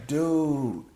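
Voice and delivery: family calms him, very soft; soothing family. Retold or imitated. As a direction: settle him down gently. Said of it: "They're like".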